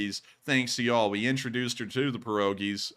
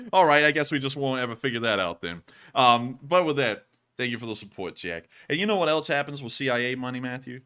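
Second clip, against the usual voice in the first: usual speaking voice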